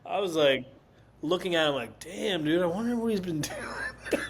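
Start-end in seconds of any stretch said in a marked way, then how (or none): 2.0-4.2 laughing awe. Retold or imitated. Quoting own awed thought.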